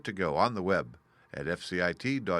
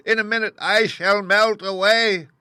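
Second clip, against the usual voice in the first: very high, loud frightened wail; panicked witch; 12 semitones higher, 12 dB louder than usual. A narrator's reading. Witch shrieking in fear as she melts. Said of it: "she screamed".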